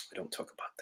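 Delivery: breathy pointed whisper, barely voiced. Real, not acted. To his online critics, a pointed whisper. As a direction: drop to a secret whisper.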